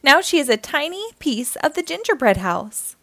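plain reading voice

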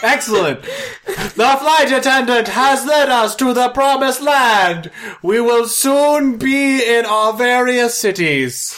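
high, loud cabin announcement; flight attendant announcement. Acted.

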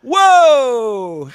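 high, loud mock surprise; exaggerated whoa. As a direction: burst in with a big whoa. [name]'s hammed entrance.

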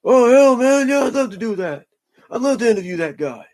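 a tough Texan happily saying yes to the idea; Stone Cold impression. Host imagines wrestler eagerly agreeing, hammed-up high Texan voice. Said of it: "Stone Cold being like".

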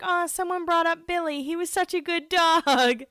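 mock-tender coo about the dog; fond imitation of Alex. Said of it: "she was like".

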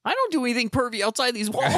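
high frog puppet voice; Kermit the Frog. Retold or imitated. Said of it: "going more Kermit there".

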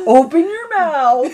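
high, loud, drawn-out mock order; exasperated parent. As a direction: plead loudly with a stubborn eater. Retold mother feeding stubborn child.

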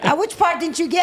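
demanding which part; challenging. Real, not acted. Wife challenging the interviewer.

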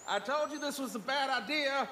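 a distant grumble heard from the background; far off background voice. Acted. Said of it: "you can hear from the background".

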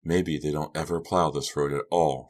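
ordinary delivery

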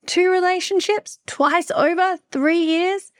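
high-pitched, exasperated disbelief; incredulous. Retold or imitated. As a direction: count them off high, ending in a question. Acted disbelief at repeating the pattern.

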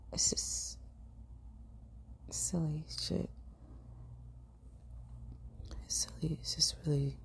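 soft whispered aside. Real, not acted. Telling viewers about her odd habits, whispered aside. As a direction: breathe it out to yourself. Muttering to herself, baffled.

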